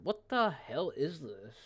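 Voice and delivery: a lightly done imitation of someone zoned out; mocking slurred stammer. Streamer mimicking the ranting guy's slurred pauses, mocking. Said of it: "He's like".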